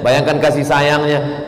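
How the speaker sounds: normal talking voice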